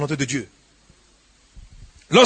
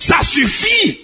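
fed-up shout to God; exasperated cry; 10 semitones higher, much slower than usual. Acted. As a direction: cry out that you've had enough. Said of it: "pour que vous dites".

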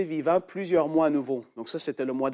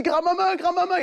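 high, raised call to grandmother. Retold or imitated. Boy calling his grandmother, high raised voice. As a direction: call her like a frightened boy. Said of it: "il appelait".

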